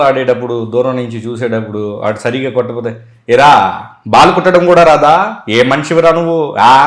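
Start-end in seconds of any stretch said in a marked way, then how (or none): 3.3-6.7 loud, high-pitched mimicked scolding; Prakash Raj. Mimic scolding friends over a missed football kick, loud and overbearing. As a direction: berate them like a domineering film heavy. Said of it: "Prakash రాజు లాగా బాగా మాట్లాడేవాడిని".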